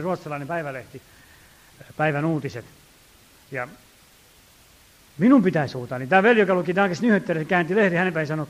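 normal talking voice

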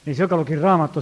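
ordinary delivery

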